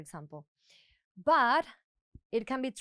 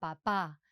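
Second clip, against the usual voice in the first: "papá" lower, leaning on the final "pá"; stress on last syllable; 7 semitones lower than usual. Shown as a demonstration. Teacher repeating the word for dad, "papá" stressed on the last syllable. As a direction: land on the second syllable of "papá". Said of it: "if we pronounce like that".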